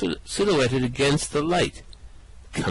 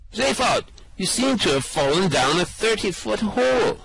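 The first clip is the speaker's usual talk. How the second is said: calling down; 8 semitones higher than usual. Acted. Calling down to Zaphod stuck in the hole. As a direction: shout it down the hole.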